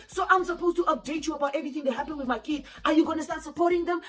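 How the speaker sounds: high mock-indignant questioning; getting defensive. Boyfriend asked about his kids, acted defensive. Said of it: "he started getting defensive".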